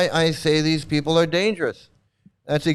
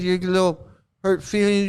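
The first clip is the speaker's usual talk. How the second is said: usual speaking voice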